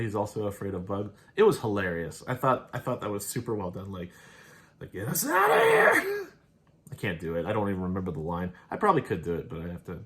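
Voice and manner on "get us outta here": mock panicked scream, far higher and louder, slowed; high-pitched scream. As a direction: squeal it way up high like a tough guy losing it over bugs. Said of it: "has this really high-pitched scream".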